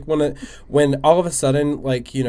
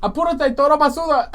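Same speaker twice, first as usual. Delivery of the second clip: higher Dominican Spanish uncle voice; 10 semitones higher than usual. Retold or imitated. A friend hearing a Dominican uncle impression. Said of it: "You sounded like a Dominican uncle".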